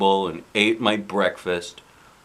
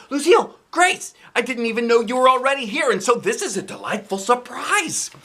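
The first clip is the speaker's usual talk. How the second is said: put-on high-pitched, thrilled child's greeting; delighted surprise; 11 semitones higher than usual. Junie B greets Lucille and Aunt Grace in the car, delighted.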